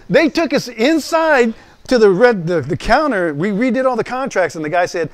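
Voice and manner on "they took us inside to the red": usual speaking voice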